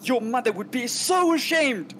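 high, loud scolding butler. Acted. Butler yells down the corridor at fleeing lord, scolding loudly. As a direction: call out a reproach down the corridor. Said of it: "I'm gonna raise my voice".

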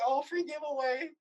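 a hint of a mock old lady. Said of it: "Is that the old lady voice?".

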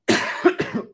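choking cough; coughing fit. Real, not acted. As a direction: cough hard, something went down wrong. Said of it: "ho dato di traverso".

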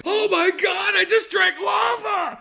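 very high, strained mock scream; drawn-out hammed-up shriek of agony; struggling. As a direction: scream as if your mouth is burning. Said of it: "you could see he's struggling".

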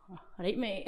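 casual Glaswegian greeting; lightly put-on Glasgow accent; matey Glaswegian hello; Glaswegian English. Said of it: "when I speak English, it's like".

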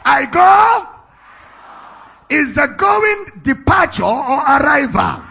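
loud, high declaimed challenge; preacher's ringing, high-volume delivery; full-force preaching. Real, not acted. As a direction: shout the question out to the congregation.

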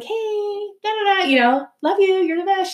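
high, syrupy buttering-up; sweet-talking. Retold or imitated. Asking a friend for an airport ride, sugary sweet-talk. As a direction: coax her like you want a favor.

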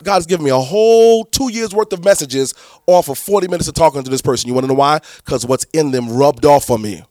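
usual speaking voice

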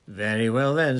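loud, satisfied go-ahead; full-voiced, pleased with the victory; gleeful. A narrator's reading. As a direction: announce it with triumphant glee. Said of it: "now radiant with glee".

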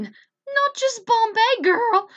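high, loud, drawn-out sass; sassy aside. Real, not acted. To her co-host about the fish, a sassy high loud jab. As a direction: toss it at her like a diva, slow and loud.

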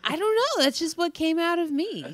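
high teasing flirty voice; Naughty Nina voice. Acted. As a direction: play coy and high-pitched. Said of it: "taunting Naughty Nina like this?".

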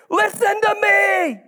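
pleading cry. Shown as a demonstration. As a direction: beg to be heard. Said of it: "That's the message of the scripture right here".

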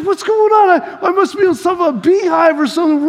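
high-pitched bewildered yelp of words; mock alarm. Boy wrestling while something stings him, mock alarm. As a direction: act out rising panic at mystery stings. Acting out being stung mid-fight.

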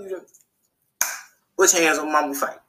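ordinary delivery

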